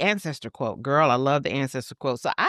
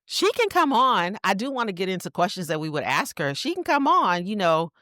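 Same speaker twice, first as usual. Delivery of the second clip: sassy; 7 semitones higher than usual. Real, not acted. Sassy invitation to Kamala.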